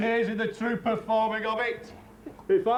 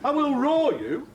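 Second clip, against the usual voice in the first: ordinary talk, nothing marked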